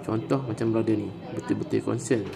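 ordinary delivery

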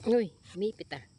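sudden loud startled "uy"; startled outcry. Real, not acted. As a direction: jump at the saw jamming. Saw suddenly jams in the branch.

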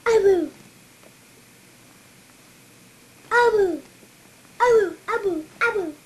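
loud excited shouts; shouting. Real, not acted. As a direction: yell the nickname gleefully. Child shouting baby's nickname.

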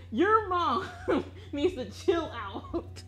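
high, laughing "your mom", drawn-out finish; laughing scolding. Real, not acted. Teasing a friend about his mom, laughing. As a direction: scold through a laugh, breaking up midway.

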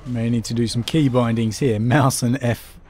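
ordinary talk, nothing marked

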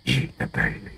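breathless whispered aside. Acted. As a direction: hiss it like a secret.

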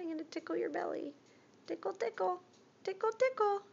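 high, fast playful tickle talk; giggly tickle attack. Real, not acted.

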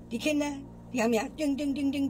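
usual speaking voice

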